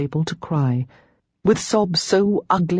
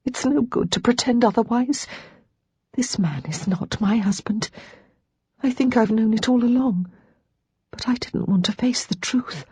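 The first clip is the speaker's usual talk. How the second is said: wretched confession; 7 semitones higher than usual. Acted.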